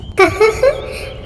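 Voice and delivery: a light amused laugh, rising; gentle high laugh. Acted. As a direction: chuckle softly like a kind fairy. Spirit laughs at birds' demand.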